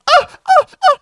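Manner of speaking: very high, loud mock moans; mock moaning. GM imitates moans heard behind the bar. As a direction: ham up the noises behind the bar.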